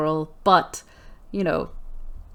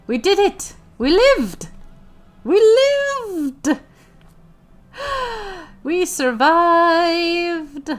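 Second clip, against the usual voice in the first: triumphant cheer; 8 semitones higher, much slower than usual. Pretend. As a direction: cheer like you barely survived. Triumph after a battle.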